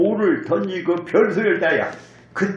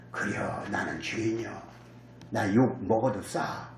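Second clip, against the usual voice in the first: much softer and lower, humbly admitting fault; humble confession; 10 semitones lower, 10 dB quieter than usual. Shown as a demonstration. Preacher voicing a humble believer, much softer and lower. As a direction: let it fall soft and low, a sinner accepting blame. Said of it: "요렇게 생각해야 하는 거예요".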